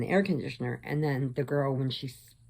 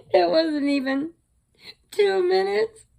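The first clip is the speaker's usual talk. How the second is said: laughing; 14 semitones higher, 5 dB louder than usual. Real, not acted. Laughing while retelling the fiasco.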